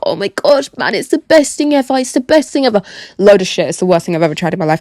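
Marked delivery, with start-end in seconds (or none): 0.0-2.8 lightly voiced gushing fan; a hint of over-the-top hype, higher; mock hype. Mocking hyped-up friends, mildly raised gushing voice. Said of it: "everyone around you is hyping it up".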